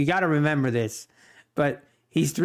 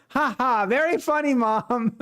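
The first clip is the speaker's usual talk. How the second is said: put-on high, teasing ha ha like a three-year-old; mock sarcastic toddler; 7 semitones higher than usual. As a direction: imitate a cheeky kid mocking his mom, much higher. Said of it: "He goes".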